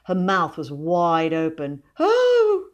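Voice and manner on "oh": very high, loud gasp of wonder. A narrator's reading. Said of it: "she gasped".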